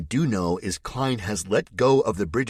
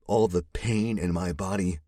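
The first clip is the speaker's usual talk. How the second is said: ordinary delivery, nothing marked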